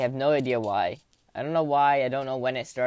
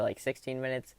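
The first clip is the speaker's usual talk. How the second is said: ordinary delivery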